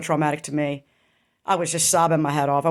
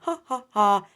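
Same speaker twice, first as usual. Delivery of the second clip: mocking imitated ha ha ha, voice higher; mock laugh; 4 semitones higher than usual. Mother laughing off the brother's wrongdoing, mock scornful laugh. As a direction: mimic her scornful laugh. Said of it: "She laughed".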